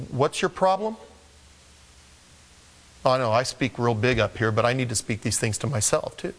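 ordinary talk, nothing marked

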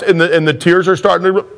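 ordinary talk, nothing marked

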